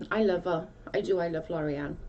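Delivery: ordinary delivery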